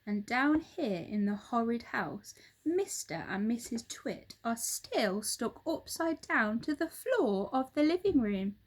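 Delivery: plain reading voice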